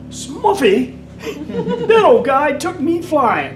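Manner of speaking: put-on high, slow, laughing old pilot voice; old South African. Old South African pilot recalls Smuffy, high and laughing. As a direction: lightly mimic a delighted old South African. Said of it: "He goes".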